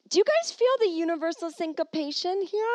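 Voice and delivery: high, loud spooky wonder; hammed-up cosmic wonder; mock mystical. Asking listeners about the spooky coincidence.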